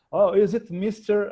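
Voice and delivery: slow hesitant foreign caller; imitating a caller. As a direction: drag out the words unsure of the name. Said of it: "tinha sempre uma pausa dramática". (imitando um atendente estrangeiro hesitante)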